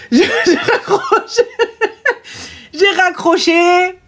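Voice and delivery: high, drawn-out words through laughter; laughing. Real, not acted. Storyteller admits hanging up on the client, laughing. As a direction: giggle while confessing, stretching it slowly.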